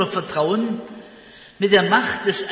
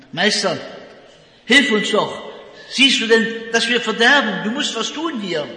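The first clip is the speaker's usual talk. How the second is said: verzweifelter Hilferuf; 3 semitones higher, 4 dB louder than usual. Acted. Jünger rufen im Sturm um Hilfe.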